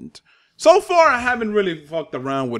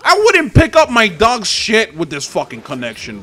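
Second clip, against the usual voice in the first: loud rant; 5 semitones higher, 5 dB louder than usual. Real, not acted. Ranting about bad connection.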